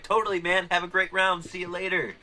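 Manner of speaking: high, breezy mock farewell; retold cheery send-off. Organizer seeing off a player Friday morning, mock-cheery. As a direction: imitate yourself blithely wishing a player well.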